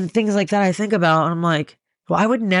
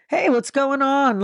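much higher, slow, bright hello; chipper greeting; 10 semitones higher, slower than usual. Retold or imitated. Greeting an old acquaintance met on the street, chipper. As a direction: sing out a friendly hey. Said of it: "I'd be like".

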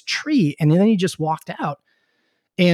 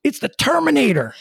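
excited reveal; 12 semitones higher than usual. Retold or imitated. President points at the governor.

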